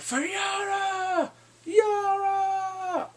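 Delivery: slow, high drawn-out shout; battle cry. Retold or imitated. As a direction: roar it as a war cry. Theon's battle cry for Yara.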